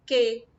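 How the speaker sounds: single mouth opening; one syllable said out. Shown as a demonstration. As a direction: say it as one clean beat. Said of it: "Eu abri a boca uma vez só". (uma sílaba bem marcada)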